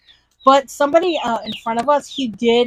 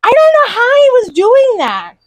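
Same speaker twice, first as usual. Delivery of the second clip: amazed; 11 semitones higher, 6 dB louder than usual. Real, not acted. Amazed by the green screen.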